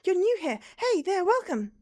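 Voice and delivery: high, loud bright welcome; cheery greeting. Acted. The purgatory local loudly welcomes the newcomer. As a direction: greet them like a delighted host.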